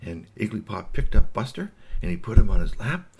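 ordinary delivery, nothing marked

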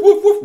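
high loud yappy woofs; robo dog barks. Acted. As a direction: bark like a tiny robot dog. Robo dogs barking.

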